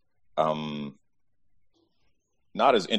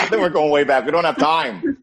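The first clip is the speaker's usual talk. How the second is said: high, loud, fast joking objection; laughing protest; 11 semitones higher, 6 dB louder than usual. Real, not acted. Host teases guest starting from birth, laughing protest. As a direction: burst in laughing, pitch shooting up.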